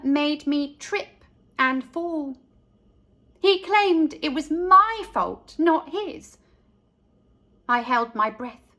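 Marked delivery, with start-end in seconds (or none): none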